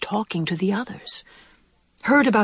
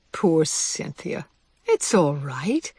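soothing mother; 2 semitones lower, much slower than usual. Acted. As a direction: comfort a worried child gently and slowly. Mother comforts her tearful child.

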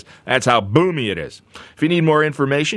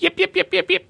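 much higher yappy little dog yips; yipping poodle. Retold or imitated. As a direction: yip like a frantic tiny poodle. Imitates poodle barking.

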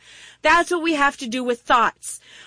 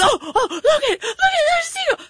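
much higher, squealing excited lady; 13 semitones higher, 3 dB louder than usual. Retold or imitated. RV lady calls her husband about the seagull, squealing high. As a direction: point out the bird in high alarm. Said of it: "she goes".

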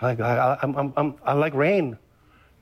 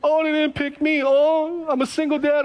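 mock self-pity; 5 semitones higher than usual. Mocking self-pitying complaints.

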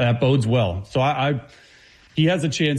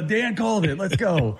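higher-pitched mock celebration; excited reaction; 6 semitones higher than usual. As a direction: act out a thrilled I-told-you-so moment. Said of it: "call back on this moment".

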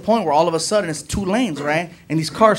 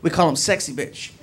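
normal talking voice

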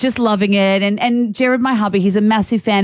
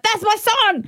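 high swooning "That's my son"; proud mum cheer; 11 semitones higher, slower than usual. Retold or imitated. Mum cheering her son's soccer goal, in a high gushing cheer. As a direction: gush like a proud courtside mum. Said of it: "I was like".

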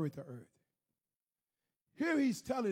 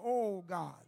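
low wordless moans between sighed pleas; moaning prayer; 4 semitones lower, slower than usual. Retold or imitated. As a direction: moan a private prayer, trailing lower. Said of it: "Just, just that moan".

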